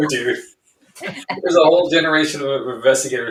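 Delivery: laughing. Real, not acted. Laughing at joke about bag.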